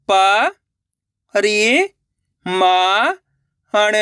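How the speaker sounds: प, रि, मा, हण drawn out with gaps; syllable by syllable. Shown as a demonstration. Teacher breaking a word for learners, syllable by syllable. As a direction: sound out प, रि, मा, हण singly. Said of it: "एक अक्षर का उच्चारण करके".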